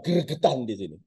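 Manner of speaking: usual speaking voice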